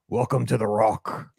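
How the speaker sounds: low, slow movie-hero delivery; movie line impression. Host quotes a prison film to friends, movie line impression. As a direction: intone it like a dramatic film quote.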